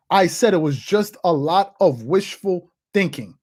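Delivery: slow, deliberate delivery, word by word; measured I-told-you-so, spaced out; slow emphatic repeat. Real, not acted. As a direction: drive the point home slowly. Repeats own claim slowly for emphasis.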